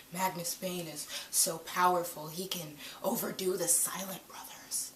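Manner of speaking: hushed, intense soap-opera delivery; melodramatic soap opera. Retold or imitated. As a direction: drop to a dramatic near-whisper. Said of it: "all that melodramatic soap opera feeling".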